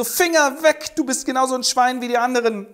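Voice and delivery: high, loud, rushing rebuff; indignant shout. Acted. Helene fending off the groping brother-in-law, shouting indignantly. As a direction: slap his hand away with your voice.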